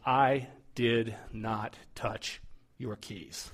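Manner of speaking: each word slow and deliberate; imitating his wife's slow, pointed denial. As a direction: spell it out slowly, daring him to argue. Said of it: "and she slows down her voice".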